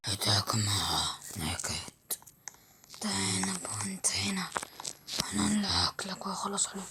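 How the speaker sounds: low, whispered beast voice; a rasping creature hissing its threats; lion voice. Acted. Boy turned lion taking on the beast, low whisper.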